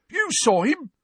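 high, loud disbelieving caretaker; incredulous Filch. Acted. Filch turning on Cho, high incredulous voice. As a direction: round on her in disbelief.

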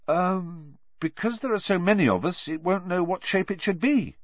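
hesitant start, then answer the teacher; schoolboy answering. Acted.

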